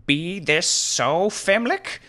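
high, slow theatrical challenge; demanding. Acted. Angry wizard challenging the thief, demanding. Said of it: "he demanded".

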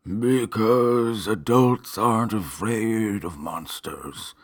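slow, drawn-out weary monster. A narrator's reading. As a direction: drag each word like a tired ghoul. Same monster continues.

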